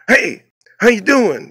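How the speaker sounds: high, slow mock Italian-American "how you doing"; Italian-American greeting. Mafia owner greeting the worker in an Italian-American accent. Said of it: "he's an Italian guy".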